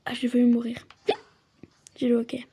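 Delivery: breathy unvoiced whisper. Real, not acted. Player whispering that she has the hiccups. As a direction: hush it out between hiccups.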